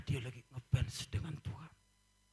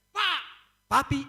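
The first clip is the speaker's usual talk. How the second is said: calling husband; 10 semitones higher, 7 dB louder than usual. Retold or imitated. Wife calling husband in story.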